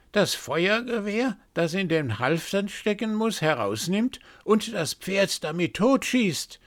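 a raven gloating over the coming doom; high raven prophecy. A narrator's reading. First raven to the others, high ominous prophecy.

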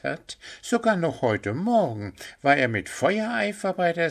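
high, bright-eyed deer voice; eager young deer. A narrator's reading. Deer Pünktchen defends Willi to Puh, high eager voice. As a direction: insist with admiring enthusiasm.

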